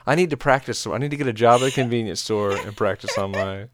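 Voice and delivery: usual speaking voice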